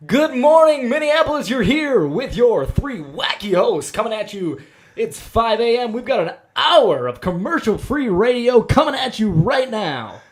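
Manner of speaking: very high, loud, wacky radio host; morning radio voice. Pretend. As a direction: shout a cheesy wake-up greeting like a morning DJ. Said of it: "our very wildly average intro if we were five thirty in the morning radio show hosts".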